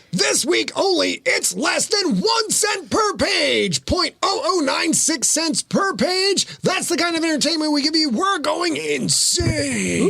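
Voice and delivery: high, slow, shouted bargain pitch; mock ad hype. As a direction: sell the price like a crazy TV ad.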